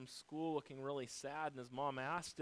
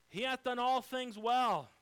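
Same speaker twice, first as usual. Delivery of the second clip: much higher and louder, proclaiming "He hath done all things well" with "all" hit hardest; booming proclamation; 10 semitones higher, 12 dB louder than usual. Acted. Preacher proclaims scripture refrain.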